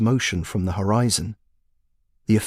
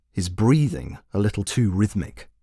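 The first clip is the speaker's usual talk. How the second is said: usual speaking voice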